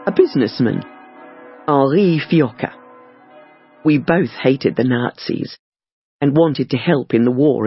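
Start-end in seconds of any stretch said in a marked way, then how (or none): none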